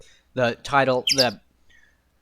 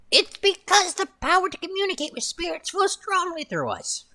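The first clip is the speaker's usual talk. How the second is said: put-on high, thin old witch voice; 12 semitones higher, 4 dB quieter than usual. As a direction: croak it out like a witch. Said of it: "I just made her voice a witch voice".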